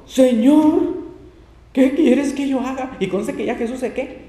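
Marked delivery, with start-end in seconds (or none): 0.1-3.0 louder and higher, slow, like a man shaking with fear; trembling and fearful. A narrator's reading. Preacher reads Saul fearfully asking the Lord, trembling and fearful. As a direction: let Saul's terror lift the voice, slowly drawn out.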